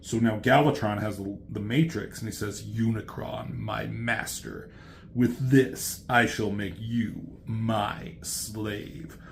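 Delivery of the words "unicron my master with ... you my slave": slow, hushed villain voice; quiet, measured acted menace; gloating villain. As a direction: draw out the threat softly and deliberately. Acting villain addressing his master.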